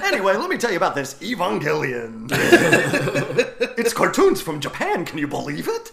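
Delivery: high manic comedian voice; loud, giddy hammed-up showman pitch; Robin Williams impression. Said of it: "Robin Williams to film an intro".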